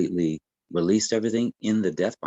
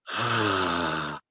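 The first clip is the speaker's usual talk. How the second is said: long exhale sigh. Shown as a demonstration. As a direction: let the breath fall all the way out. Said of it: "All the way down".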